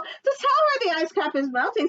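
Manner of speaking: high, loud little bird voice. Acted. Bird telling the fox the news, high and eager. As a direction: pipe it up eagerly. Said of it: "said Dubbeck-, Dubbecky Lovecky".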